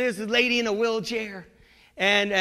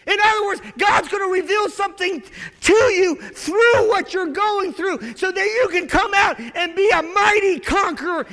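full-force preaching; 8 semitones higher, 6 dB louder than usual. Real, not acted. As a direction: hammer each line at the congregation.

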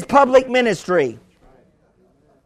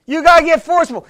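ordinary talk

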